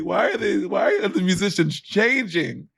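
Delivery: high, slow mock whine; hammed-up tearful complaint; imitated sobbing. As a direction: wail the question in exaggerated distress. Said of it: "like, sobbing".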